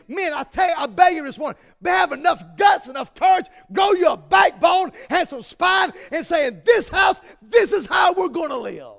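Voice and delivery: loud, high pleading shout; preaching shout. Real, not acted. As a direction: beg them at full force. Preacher exhorting men at full force.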